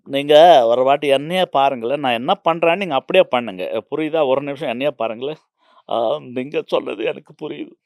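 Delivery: loud, insistent Tamil director instructing an actor; Kamal Haasan in Tamil. Retold or imitated. Director mimics Kamal Haasan coaching a weak actor, loud and insistent. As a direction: coax the actor to copy you, with force. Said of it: "మీరు బాగా imitate చేస్తున్నారు".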